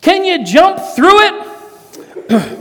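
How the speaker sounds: high, slow mock yell from the crowd; young man down front yells a dare, high and drawn out; kid yelling out. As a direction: call it out like a cheeky kid up front. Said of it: "he yells out".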